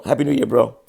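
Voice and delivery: usual speaking voice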